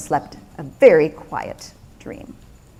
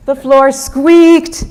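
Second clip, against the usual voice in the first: squeaky voice; 6 semitones higher, 6 dB louder, much slower than usual. A narrator's reading. Floor sound acted in narration.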